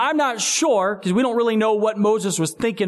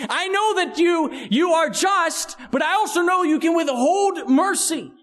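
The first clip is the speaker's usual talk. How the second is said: earnest prayer; 8 semitones higher than usual. Acted. Voicing a prayer to God.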